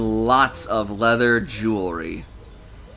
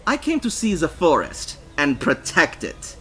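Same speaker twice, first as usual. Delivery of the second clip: earnest, deliberate declaration, pitched up; deadly serious; 6 semitones higher than usual. A narrator's reading. Foreigner on coming to protect the forest, deadly serious. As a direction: declare it as a solemn mission. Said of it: "They sounded deadly serious".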